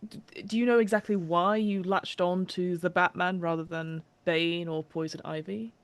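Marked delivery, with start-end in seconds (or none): none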